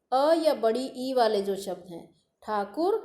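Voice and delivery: usual speaking voice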